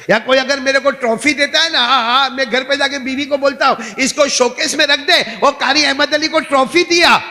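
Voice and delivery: high, excited mock bragging; mock boasting. Preacher acts out boasting to his wife about a trophy, mock boasting. Mocks own pride in shawls, trophies.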